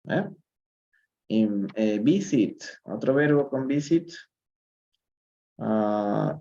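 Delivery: ordinary talk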